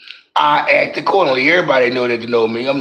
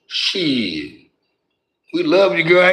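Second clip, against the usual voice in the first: usual speaking voice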